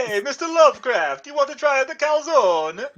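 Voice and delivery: high, cartoonish Italian voice; loud Italian caricature, offering food; Italian accent. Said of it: "You do the Italian voice the best".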